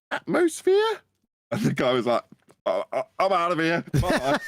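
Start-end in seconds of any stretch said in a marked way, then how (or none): 0.1-1.0 "atmosphere?" thrown up with a big high rise; mocking question. Mocking rise at flat earth dome. 2.6-4.4 flustered exit. Retold or imitated. Retells guy storming off.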